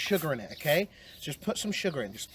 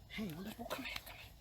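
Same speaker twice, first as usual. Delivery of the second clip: whisper; 20 dB quieter, faster than usual. Real, not acted. Coaxing scared dog.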